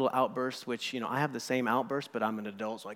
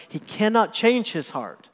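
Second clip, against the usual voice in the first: loud, slow and much higher, each word pressed; emphatic preaching; 9 semitones higher, 11 dB louder than usual. Real, not acted. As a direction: hammer home that he cannot change.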